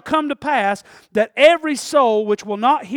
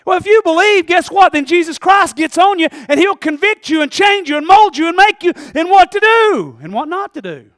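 loud, rising sermon build; preaching at full force; 7 semitones higher, 10 dB louder than usual. Real, not acted. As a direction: preach it out to the congregation.

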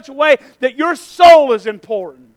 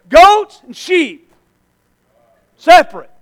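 emphatic declaration; 2 semitones higher, 7 dB louder than usual. Real, not acted. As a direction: pound each word like a verdict. Preacher driving home the judgment.

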